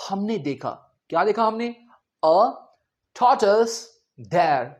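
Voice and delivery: usual speaking voice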